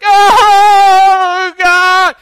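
long, very high and very loud anguished cry; anguished wail. Retold or imitated. Businessman praying in anguish, long loud wail. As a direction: wail it out, racked with conviction. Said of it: "Racked, racked with conviction".

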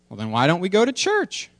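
high-pitched cartoon child voice; little daughter. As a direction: put on an eager little kid asking Daddy. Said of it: "I'll read the cartoon to you".

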